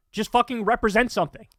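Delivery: normal talking voice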